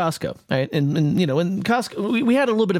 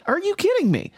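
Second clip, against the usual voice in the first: disbelief; 12 semitones higher than usual. Retold or imitated. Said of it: "and I'm like".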